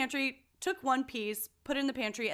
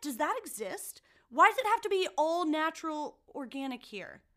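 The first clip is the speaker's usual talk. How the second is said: furious rant; 7 semitones higher than usual. Real, not acted.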